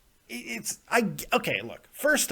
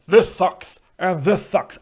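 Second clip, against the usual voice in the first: mock lazy review; 3 semitones higher, 6 dB louder than usual. Shown as a demonstration.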